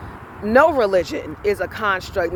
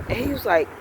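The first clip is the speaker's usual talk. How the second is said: ordinary talk, nothing marked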